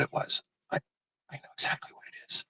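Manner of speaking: hushed, fast whisper. Real, not acted. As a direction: let us in on a secret, whispered. Secretive hushed reveal.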